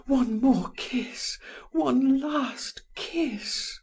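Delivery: high, slow, tearful plea; crying in despair. A narrator's reading. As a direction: beg for the kiss through tears. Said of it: "she cried in her despair".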